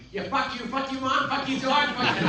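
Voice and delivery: high, loud mock Boston cursing; imitated Boston accent. Young actor auditioning, high loud imitated Boston accent. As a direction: spit curses in a put-on Boston accent. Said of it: "How do you do that so good?".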